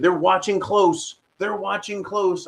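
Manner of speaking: high-pitched parroting of an official claim; mocking quote. Host repeating what they said about watching, mockingly. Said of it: "that's what they said".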